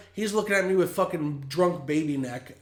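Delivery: normal talking voice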